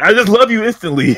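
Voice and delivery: high, loud mock declaration of love; mock gushing. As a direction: parody an instant movie romance. Mocking a rushed movie romance.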